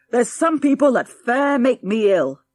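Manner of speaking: a cranky great-grandad fuming loudly; angry old man. A narrator's reading. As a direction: spit it out as you get up to leave. Old man storms out angrily.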